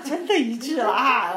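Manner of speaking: loud, high, drawn-out astonished cry; reliving a shared shock at seeing a healing; stunned amazement. Retold or imitated. Onlookers marvelling at the healed man, stunned amazement. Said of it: "我们这些人也都吓傻了啊".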